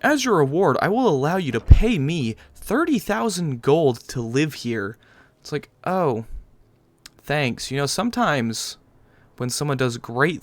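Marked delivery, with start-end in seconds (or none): 0.0-5.0 mock jarl voice. Mocking the jarl's offer.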